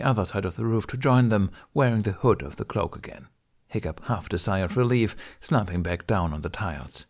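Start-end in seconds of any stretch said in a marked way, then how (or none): none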